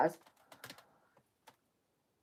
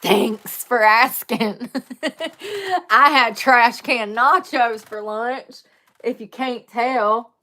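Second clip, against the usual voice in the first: slow, louder, sardonic thanks; mock sarcastic reply; 3 semitones higher, 8 dB louder, slower than usual. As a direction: answer the rude question with fake politeness. Sarcastic answer about bad breath.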